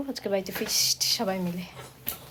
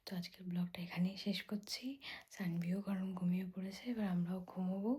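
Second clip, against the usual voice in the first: whispered late-night sign-off; soft whispered good night; whispering; 3 semitones lower, 15 dB quieter than usual. Real, not acted. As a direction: whisper the goodbye so nobody wakes. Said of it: "আমি আস্তে কথা বলছি".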